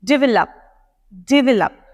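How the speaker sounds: develop with the stress on de; develop, de said strongest. Shown as a demonstration. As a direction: lean on the de in develop. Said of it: "stress करना है on डीई".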